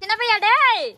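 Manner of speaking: put-on high, loud shout for the boy; calling out. Mother calling out to her boy, loud shout. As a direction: holler his name, drawing out the last word.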